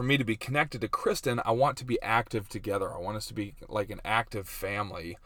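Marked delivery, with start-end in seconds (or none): none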